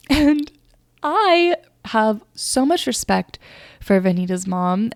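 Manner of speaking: normal talking voice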